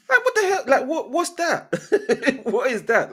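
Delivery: high-pitched, laughing incredulity; baffled disbelief. Real, not acted. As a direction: burst out laughing in genuine bewilderment. Laughing disbelief at club's transfer choices.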